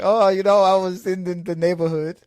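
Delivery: high, loud Carpathian-accented impression; hammed-up Carpathian movie character; Janos. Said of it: "it's just the way he talked was funny".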